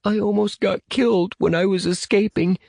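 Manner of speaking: put-on high child's voice near tears; plaintive and high, holding back tears; shaky, tearful boy. A narrator's reading. Little boy tells rescuers of his escape, shaky and tearful. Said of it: "his voice was shaky".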